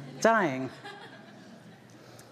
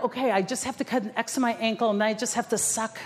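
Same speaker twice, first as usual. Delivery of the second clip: mock panic; 4 semitones higher than usual. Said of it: "I'm like".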